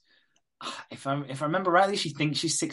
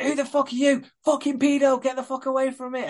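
panicked yell; 12 semitones higher than usual. Retold or imitated. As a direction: scream it at a stranger you fear. Confused Susan yells at Carl.

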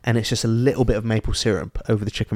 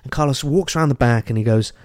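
ordinary talk

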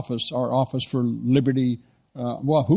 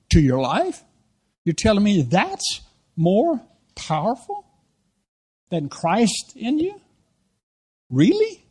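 slow, halting disbelief, pitched up; incredulous rebuke; 4 semitones higher, slower than usual. Retold or imitated. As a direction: drive it home in pieces, ending in high incredulous questions. Acted confrontation over bowing to cigarette.

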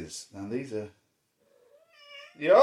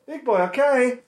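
high, loud sing-song call; calling the dog; 11 semitones higher, 9 dB louder than usual. Real, not acted.

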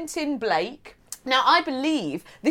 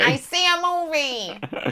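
slow, higher mystic fortune teller; psychic hotline; 5 semitones higher, slower than usual. Pretend. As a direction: intone it like a psychic. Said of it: "echoing psychic line".

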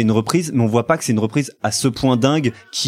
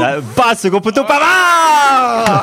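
ecstatic goal call, much higher and louder; french sports commentary; 8 semitones higher, 4 dB louder than usual. Real, not acted. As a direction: yell the scorer's name like a commentator. Goal call while rewatching match.

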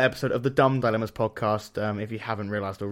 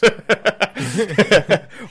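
loud laugh; 8 semitones higher, 8 dB louder than usual. Real, not acted. Burst of laughter.

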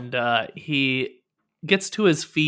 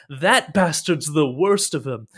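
higher, emphatic griping; aggrieved; 4 semitones higher, 4 dB louder than usual. Acted. Captive gripes about his clumsy captor, aggrieved. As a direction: complain bitterly, hitting the key words.

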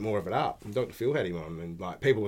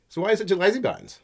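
high, quick mock-innocent dig; passive-aggressive; 9 semitones higher, faster than usual. Agent needling a rude shopper, mock passive-aggressive. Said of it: "he just starts being real passive-aggressive".

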